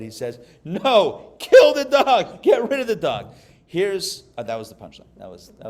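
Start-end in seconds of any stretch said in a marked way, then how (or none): 0.6-3.3 higher, much louder, slowed panicked yell; panicked shout. Retold or imitated. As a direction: yell it in horror, dragging kill the dog.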